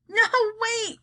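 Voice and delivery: high, urgent cry; a boy's high cry of protest. A narrator's reading. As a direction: call out in sudden alarm. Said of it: "cried Charlie".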